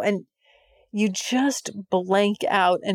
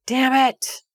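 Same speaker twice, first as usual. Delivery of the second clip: higher and louder, a frustrated yelp of damn it; frustrated outburst; 6 semitones higher, 4 dB louder than usual. Real, not acted. Frustrated at her own horniness.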